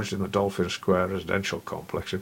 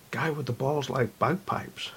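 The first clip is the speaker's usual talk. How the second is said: put-on higher-pitched, girlish New Jersey voice; girly New Jersey accent; 6 semitones higher than usual. A narrator's reading. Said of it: "in a girly New Jersey accent".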